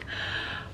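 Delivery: a long, trembling breath out; shaky sigh. Real, not acted. Overwhelmed by her weight loss, a shaky sigh. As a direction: let the emotion out in a sigh.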